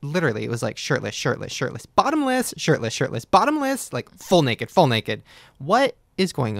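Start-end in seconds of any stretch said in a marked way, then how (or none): 1.9-2.5 bottomless jumps high; comic emphasis. Real, not acted. Host teasing guest about photos, high comic jump. 3.2-3.9 bottomless jumps high; comic emphasis. Real, not acted. Host teasing guest about photos, high comic jump.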